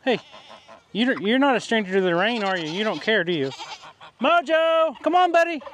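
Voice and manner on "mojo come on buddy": calling out. Real, not acted. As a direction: call him from across the yard. Calling the dog.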